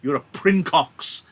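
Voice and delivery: loud, high, drawn-out sneer; slow angry insult. Acted. Capulet turning back to Tybalt, slow angry insult. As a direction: spit the insult slowly.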